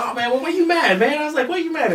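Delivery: high, mock-innocent questioning; teasing. Teasing the opponent at the gym, high mock-innocent voice. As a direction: needle him in a high, playful voice.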